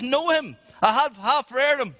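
put-on high, emphatic indignant old woman; elderly grandmotherly woman. Old witness answering about the defence lawyer, acted indignation. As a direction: play her scornfully sure of herself. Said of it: "an old grandmotherly, elderly".

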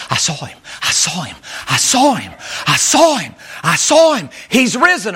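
Mary bursting with the news, rising to a shout; building joyful cry. Acted. Mary tells the disciples joyfully.

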